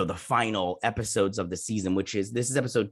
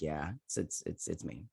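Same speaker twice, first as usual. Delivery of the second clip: hushed admission; 6 semitones lower, 13 dB quieter than usual. Retold or imitated. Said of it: "He's like".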